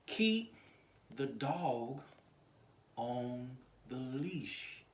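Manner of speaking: slow, deliberate, spaced-out words; slow title reading. Shown as a demonstration. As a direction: spell out the title word by word, with pauses. Reads out the video title.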